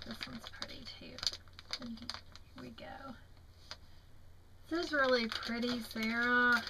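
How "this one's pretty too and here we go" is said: whisper. Real, not acted. Aside while opening pocket.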